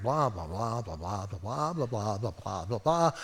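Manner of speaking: low, soft, muffled run of blahs; droning blah-blah. Retold or imitated. Preacher mimics the instructions he tuned out, low drone. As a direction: drone like instructions going unheard. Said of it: "here's what I heard".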